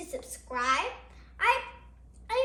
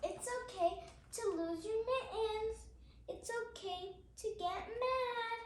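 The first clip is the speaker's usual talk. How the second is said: steady, gentle picture-book reading; reading to children; 4 semitones higher, 5 dB quieter than usual. Acted. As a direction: read each page out to little listeners. Reads picture book aloud.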